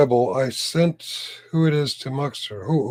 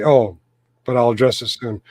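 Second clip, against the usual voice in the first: normal talking voice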